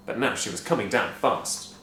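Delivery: usual speaking voice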